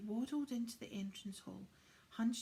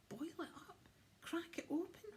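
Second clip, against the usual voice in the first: high cheeky elf; 9 semitones higher than usual. Acted. Spudcheeks repeats.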